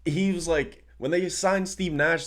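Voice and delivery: usual speaking voice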